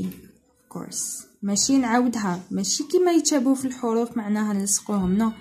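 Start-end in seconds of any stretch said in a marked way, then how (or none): 0.1-1.3 1 whispered aside. Real, not acted. Teacher answers softly.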